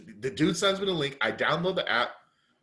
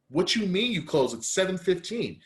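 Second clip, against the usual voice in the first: incredulous protest; 6 semitones higher than usual. Retold or imitated. Retold arguing with restaurant staff.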